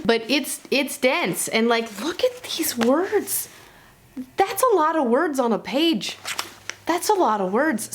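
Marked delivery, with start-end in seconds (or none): 2.0-7.9 gawk at all the words on the page; mock dismay. Real, not acted. Vlogger showing viewers the crammed page, mock dismay. Showing the dense book pages.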